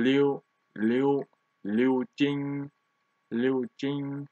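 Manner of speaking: Liu rising in second tone, Jing held level in first tone; tone demo. Shown as a demonstration. Teacher shows learners the name Liu Jing, second tone then first tone. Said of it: "second tone and first tone".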